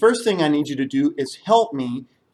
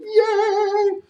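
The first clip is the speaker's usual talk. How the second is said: excited cheer. Acted. Elephant cheers.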